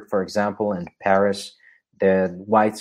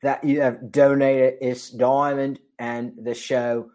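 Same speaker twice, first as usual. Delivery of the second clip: slow, higher, hammed-up Cockney attempt; bad Cockney accent; 5 semitones higher, 5 dB louder, slower than usual. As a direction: put on a clumsy Cockney accent to thank the donor. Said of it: "Sorry, I cannot do a Cockney accent".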